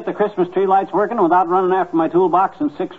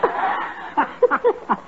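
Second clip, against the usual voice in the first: wheezing laugh; 12 semitones higher, 3 dB quieter than usual. Real, not acted. Laughing at his own joke.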